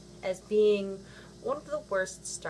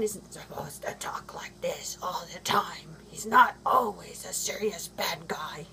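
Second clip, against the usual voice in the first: throat-straining whispered growl; Batman voice; 2 semitones lower than usual. Retold or imitated. Vlogger mocking the dark Batman films in a whispered growl. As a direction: rasp it out like a brooding caped hero. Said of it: "I can't even talk like that. It hurts my throat".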